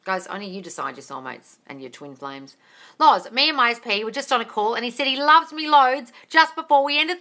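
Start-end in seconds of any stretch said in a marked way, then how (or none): none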